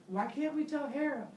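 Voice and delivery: high, puzzled wife's voice. Acted. Pandora voiced questioning.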